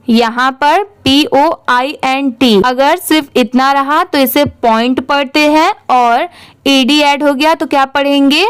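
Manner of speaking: ordinary delivery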